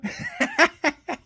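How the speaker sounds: high-pitched burst of laughter. Real, not acted. Storyteller cracking up at his own retelling, high laugh.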